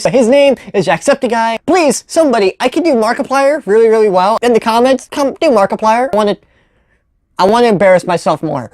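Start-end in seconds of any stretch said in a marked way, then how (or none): none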